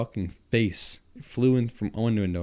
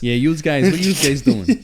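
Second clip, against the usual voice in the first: high, loud hammed-up New Jersey accent; 7 semitones higher, 9 dB louder than usual. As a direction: ham up a loud Jersey guy. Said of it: "we're from Jersey and going".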